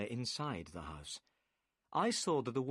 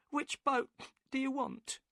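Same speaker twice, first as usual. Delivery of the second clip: higher woman's voice, upset and defensive; the boatman's wife, worried and curt. A narrator's reading. Narrator voices Mrs Smith.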